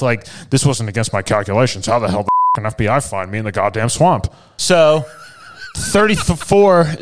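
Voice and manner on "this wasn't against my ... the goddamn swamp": shocked criminal. Retold or imitated. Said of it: "And he looks shocked".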